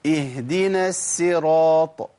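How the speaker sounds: اهدنا الصراط drawn out, ص softened toward س; teacher mimicking students; mistaken recitation. Shown as a demonstration. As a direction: imitate the common slip in اهدنا الصراط. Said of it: "كتير من الناس بيقول".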